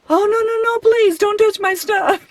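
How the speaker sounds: mock-panicked plea to keep hands off; inner panic. Her inner voice begging a stranger not to touch her groceries, mock panic. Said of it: "a part of me is saying, in my mind".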